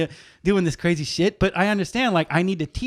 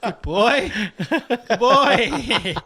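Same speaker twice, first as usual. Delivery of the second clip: higher-pitched, uneasy "good doggy" voice; nervous praise; 6 semitones higher, much slower than usual. Retold or imitated. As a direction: placate the dog through nervous laughter. Nervously soothing dog in his lap.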